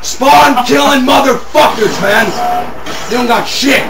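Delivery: ordinary delivery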